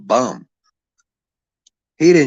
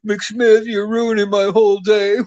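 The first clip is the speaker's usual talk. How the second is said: much higher, louder mocking whine; whiny mock Chad; 11 semitones higher, 4 dB louder than usual. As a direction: ham up a crybaby's complaint. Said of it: "Chad got all fucking weak".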